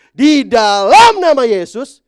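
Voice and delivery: high, loud, slow "di dalam nama Yesus"; drawn-out proclamation. Acted. Pastor proclaiming the name of Jesus, loud and slow. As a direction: stretch the name out with power.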